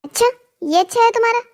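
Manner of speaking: high-pitched, incredulous question; affronted, pitch climbing in disbelief; scornful disbelief. Acted. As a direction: scoff at what you have been offered. Mother-in-law scorns the room given her.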